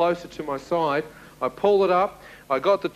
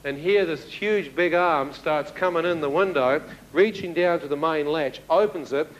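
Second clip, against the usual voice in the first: usual speaking voice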